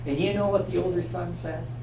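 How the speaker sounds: usual speaking voice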